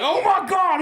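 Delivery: high, loud laughing cry of recognition; the cop realizing he pulled over Southern Grandpa, laughing and shouting; excited. Retold or imitated. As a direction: squeal it like a starstruck fan. Cop recognizing their skit.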